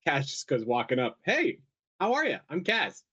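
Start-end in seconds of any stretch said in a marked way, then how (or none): 1.2-3.0 chirpy greeting. Acted. Player voices Kaz greeting.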